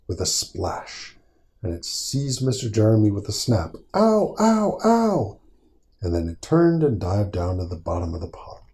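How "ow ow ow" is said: high, loud yelps of pain. A narrator's reading. Frog crying out as the trout bites, high yelps. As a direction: yelp as the trout grabs him.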